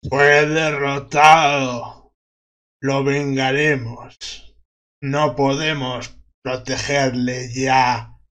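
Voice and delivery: very slow, solemn voice from the grave; undead voice. Acted. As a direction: intone it like a cold corpse. (voz lenta de ultratumba)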